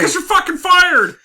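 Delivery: high, loud mock boss yelling; shouting boss. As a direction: bellow the firing like a cartoon boss. Boss firing punchline.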